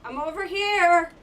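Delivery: loud, high call to the enemy. Real, not acted. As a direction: shout to lure it over. So I gotta trick it.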